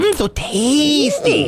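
slow, drawn-out savoring mmm. Acted.

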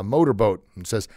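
ordinary talk, nothing marked

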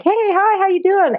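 high, singsong hello to an animal; friendly greeting. Retold or imitated. Retells greeting a dolphin.

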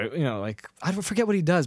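ordinary delivery

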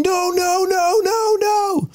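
high, loud "no" over and over; alarmed guard yelling high and loud; frantic yell. Retold or imitated. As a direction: stop him with frantic shouting. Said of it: "and yelling".

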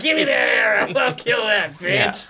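mock bloodthirsty cry. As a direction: imitate a character baying for blood. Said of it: "is crying for blood at the end".

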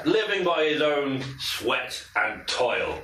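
slow, lofty declaration of freedom; proud loner. Acted. Departing hunter proclaiming his freedom, slow and lofty. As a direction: savour each word like a free man. NPC's proud farewell speech.

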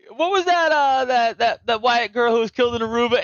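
high-pitched put-on comic voice; imitated stand-up comic. Fan retelling a comedian's bit to a friend, high put-on comic voice.

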